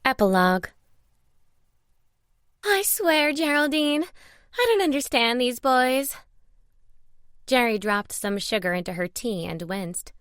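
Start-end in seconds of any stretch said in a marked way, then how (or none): 2.6-6.1 high voice, slowing down in exasperation; exasperated friend. A narrator's reading. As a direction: complain to an old friend. Margaret complains to her friend.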